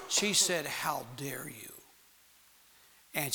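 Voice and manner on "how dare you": offended whisper. Retold or imitated. Girlfriend's offended reply retold.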